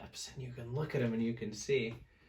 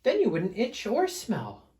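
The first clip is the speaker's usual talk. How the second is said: much higher gentle bear voice; Big Fluffy Bear asking; 8 semitones higher, 4 dB louder than usual. Acted. Big Fluffy Bear suggests Smelly Bear bathe, high voice. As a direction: ask it up high like a sweet bear. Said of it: "asked Big Fluffy Bear".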